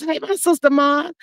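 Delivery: usual speaking voice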